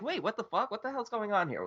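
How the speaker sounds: high, bewildered retold reaction; a hammed-up confused viewer; mock confusion.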